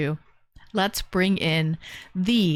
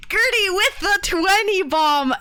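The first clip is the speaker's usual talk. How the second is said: excited shout-out; 10 semitones higher than usual. Real, not acted. Streamer hypes a donor's twenty bomb, excited high shout. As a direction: cheer her name out like an announcer.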